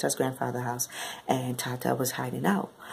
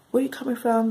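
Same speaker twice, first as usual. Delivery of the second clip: higher, louder retold confrontation; mock angry demand; 9 semitones higher, 5 dB louder than usual. Snapped fiancé questioning where she was, mock angry demand. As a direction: imitate him snapping at her.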